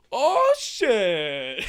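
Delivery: loud, high, stretched exclamation; mock amazement. Reader discovering this manga, hammed-up amazement. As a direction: belt it out in awed delight. His excited reaction to manga.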